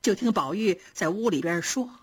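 plain reading voice

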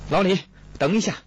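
high, hurried call; calling out while running. A narrator's reading. Kong runs up to halt Li's departure, shouting out in haste. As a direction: call urgently to stop someone. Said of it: "边跑边喊".

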